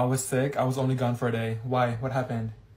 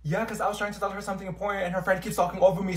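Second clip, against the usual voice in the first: ordinary talk, nothing marked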